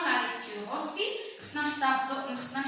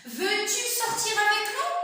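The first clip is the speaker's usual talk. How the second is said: high rising question, slow; question intonation; 8 semitones higher, 3 dB louder than usual. Shown as a demonstration. Teacher modelling an interrogative sentence with rising tune. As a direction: lift "nous" high at the end. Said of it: "Donc la phrase interrogative".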